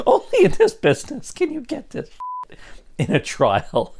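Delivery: laughing through the words, high-pitched; can barely hold the laughter in; trying not to laugh. Real, not acted. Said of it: "I'm sorry, I'm losing it now".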